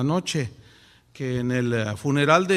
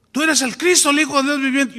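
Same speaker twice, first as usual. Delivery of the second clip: "Cristo" higher and louder, rising at the end; emphatic proclamation; 8 semitones higher, 7 dB louder than usual. Retold or imitated. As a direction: proclaim "Tú eres el Cristo" at full force. Peter's confession repeated emphatically.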